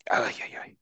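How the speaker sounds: unvoiced exasperated ay ay ay; whispered ay ay ay. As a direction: breathe out ay ay ay in despair. Groaning at annoying film.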